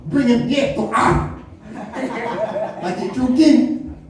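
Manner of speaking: high, hammed-up African accent; mock-angry African accent, drawn out; W'Kabi. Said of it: "You hear that African us?".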